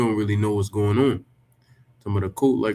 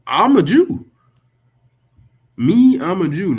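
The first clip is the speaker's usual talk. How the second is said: higher and louder, proud claim; emphatic declaration; 7 semitones higher, 7 dB louder than usual. Real, not acted. Claiming his tribe to viewers, in a loud proud declaration. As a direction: declare it loudly and firmly.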